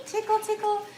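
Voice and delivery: high, sing-song tickle tickle; playful. Real, not acted. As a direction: tease it playfully. Playful rubbing on steel.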